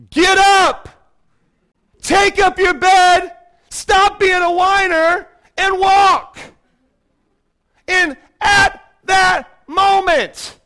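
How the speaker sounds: high, slow, loud command; commanding shout. Acted. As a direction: bark the healing order with full force. Jesus commands, preacher at full force.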